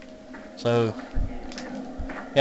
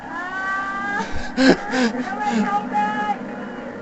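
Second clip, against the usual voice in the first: high shriek; 10 dB quieter than usual. Real, not acted. Shrieking cry at scare.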